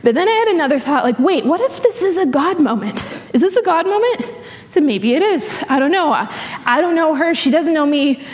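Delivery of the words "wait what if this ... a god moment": higher, eager wondering; bright sudden what-if; sudden realization. Retold or imitated. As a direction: catch yourself mid-thought with hope. Sudden realization in retold thought.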